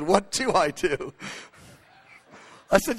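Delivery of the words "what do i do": drawn-out wondering repeat; slow, puzzled echo. Retold or imitated. Pastor echoing the Knesset member's question, slow and puzzled. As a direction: slowly turn the question over.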